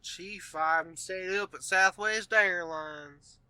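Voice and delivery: a bad, hammy Southern drawl impression; mock Southern accent, slow and lazy; fake southern accent. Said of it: "the worst Southern accent".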